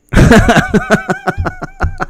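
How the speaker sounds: high squealing giggle; falsetto giggle. Real, not acted. Laughing at his own joke, a high squealing giggle. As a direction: let a high giggle burst out.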